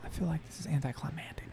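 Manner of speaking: whispered aside; whispering. Real, not acted. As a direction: whisper it quickly under your breath. Aside to the table.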